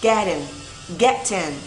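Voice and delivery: "Get in" joined into one word; linked sounds. Shown as a demonstration. Teacher asks learners to link, "Get in" run together. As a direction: carry the t of "Get in" into "in". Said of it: "đọc nối giùm [name]".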